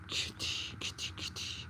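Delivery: hushed, voiceless coaxing; whispered call. Real, not acted. Owner calling the kitty over, whispered. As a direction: whisper softly to a cat.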